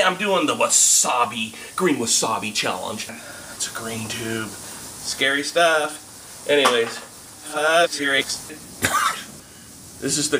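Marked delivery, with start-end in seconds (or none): none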